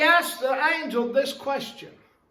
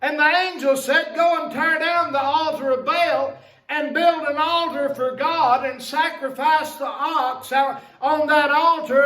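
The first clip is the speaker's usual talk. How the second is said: higher, louder, rising sermon push; fervent preaching; 5 semitones higher, 3 dB louder than usual. Acted. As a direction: drive the point home to the church. Preaching at full force.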